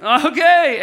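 very loud, high, exaggerated response; hammed-up awkward okay. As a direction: blurt it out with overdone social awkwardness. Acts fundraiser awkwardly working the room.

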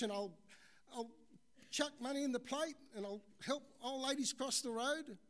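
high, loud, hammed-up begging; desperate pleading. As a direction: plead and bargain for rescue. Stranded man begs God to save him.

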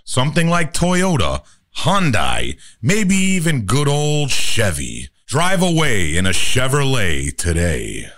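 upbeat car commercial read. Shown as a demonstration. Car company ad, upbeat pitch. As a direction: sell the Chevy. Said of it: "Car company voice".

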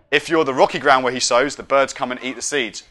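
usual speaking voice

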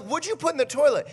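quick, high-pitched demand; exasperated questioning. Retold or imitated. Dad asking toddler what went in the toilet, exasperated. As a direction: re-enact a parent's alarmed interrogation.

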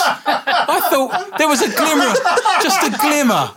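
high, loud, drawn-out comic lament; mock exasperation. Host duped by co-host's cider joke, mock exasperated. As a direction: lament it theatrically, stretching the words.

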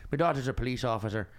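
imitated Dublin cabbie's Irish accent; Irish cab driver. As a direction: talk like an Irish driver sharing family news. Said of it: "He's like".

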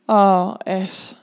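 loud drawn-out acted moan. A narrator's reading. Rick to his lover in bed, moaning. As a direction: moan the words out loudly. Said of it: "zegt hij kreunend".

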